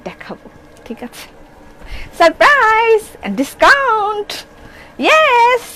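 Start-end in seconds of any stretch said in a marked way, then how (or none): 2.2-5.6 high, bright cheer: surprise, discount, yes; excited announcement. Real, not acted. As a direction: sell it like a big reveal. (উচ্ছ্বসিত ঘোষণা)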